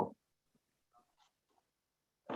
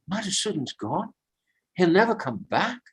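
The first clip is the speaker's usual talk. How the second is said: higher, aching disbelief at the loss; a lover's grieving cry, pitched up; heartbroken lament; 6 semitones higher than usual. Retold or imitated. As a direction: Radha grieving that Krishna is gone, higher and aching. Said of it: "she went into deep, deep separation".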